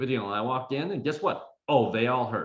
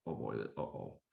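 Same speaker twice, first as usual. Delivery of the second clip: quiet dismay; 12 semitones lower, 14 dB quieter than usual. Retold or imitated. Caught with mic on.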